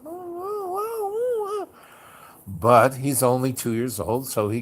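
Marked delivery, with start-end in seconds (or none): 0.0-1.7 very high mock howl; imitated toddler wail. Showing the kid trying out his scream, high mock wail. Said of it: "he's like".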